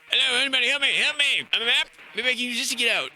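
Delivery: high, loud pretend pleas; hammed-up panic, high and loud; mock cries for help. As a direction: yell for help into the intercom, hammed up. Trapped, calling for help.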